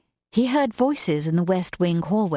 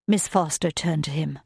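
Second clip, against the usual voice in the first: usual speaking voice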